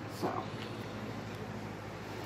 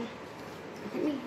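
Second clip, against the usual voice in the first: breathy whisper, no voice at all; 13 dB quieter, much slower than usual. Real, not acted. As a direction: drop to a whisper to get them to look. Mom repeats request, whispered.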